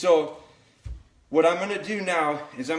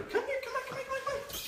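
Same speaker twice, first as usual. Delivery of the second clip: much higher, sing-song come here; high puppy call; 8 dB quieter, faster than usual. Real, not acted. Trainer coaxing the puppy over, high sing-song call.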